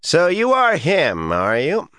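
put-on high-pitched, accented insolence; insolent sneer. A narrator's reading. Sneering man looks Rand up and down, insolently. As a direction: size him up with loud-edged scorn, high in pitch. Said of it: "He looked Rand up and down insolently".

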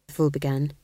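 usual speaking voice

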